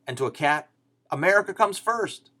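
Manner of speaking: ordinary talk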